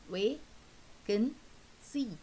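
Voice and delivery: "Way, can, see" as three separate beats of one word; syllable by syllable. Shown as a demonstration. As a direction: sound out "Way, can, see" with a pause after each. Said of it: "we take it as a syllable".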